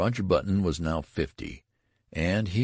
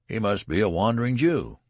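louder, higher mocking call; jeering taunt; 6 semitones higher, 6 dB louder than usual. A narrator's reading. Undergraduates chasing Benjamin with taunts, jeering. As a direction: call it out as a crowd's jeer.

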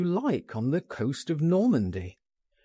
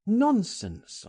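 high, emphatic exclamation; dismissive cry; 9 semitones higher than usual. A narrator's reading. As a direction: brush the fear aside with a high cry. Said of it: "I cried".

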